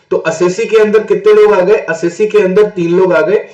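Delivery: ordinary delivery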